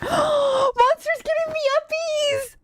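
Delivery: gasp, then a very high, drawn-out squeal; delighted squeal. Pretend. Streamer gushing as Monster lifts her, delighted squeal. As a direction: squeal like an overjoyed little fan. Streamer gushes over game moment.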